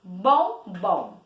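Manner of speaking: "Bombom" as two separate beats, BOM, BOM; low, split "Bombom" into two syllables; "Bombom" measured out syllable by syllable. Shown as a demonstration. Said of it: "Duas vezes".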